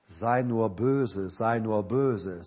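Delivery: lightly voiced devil, low and very slow; voiced devil's whisper. Devil in a children's rhyme, lightly imitated slow whisper. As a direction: draw out a low, repeated temptation. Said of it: "Wenn der Teufel kommt und flüstert".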